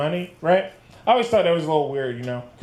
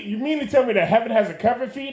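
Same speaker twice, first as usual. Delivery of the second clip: usual speaking voice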